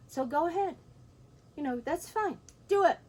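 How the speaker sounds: high, rising defiant challenge; taunting dare. Retold or imitated. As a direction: dare her to do it. Father daring mother with axe.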